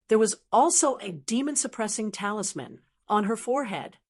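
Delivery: usual speaking voice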